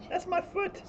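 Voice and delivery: high squeaky scolding of the cat. Real, not acted. Cat attacks foot.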